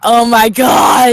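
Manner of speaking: loud amazement. Real, not acted. Co-reader reacting to the name reveal, loudly amazed. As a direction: stretch it out in real amazement.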